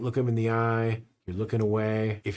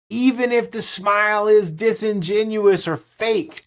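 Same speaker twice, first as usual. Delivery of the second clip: excited; 8 semitones higher, 8 dB louder than usual. Real, not acted. Hyped telemarketing pep talk.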